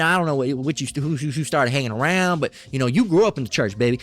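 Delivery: ordinary talk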